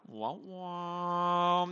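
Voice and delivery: mock sad trombone wah-wah; teasing vocal trombone; sad trombone noise. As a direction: sound a drawn-out losing horn. Imitates sad trombone at lost game.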